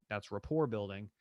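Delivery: ordinary talk, nothing marked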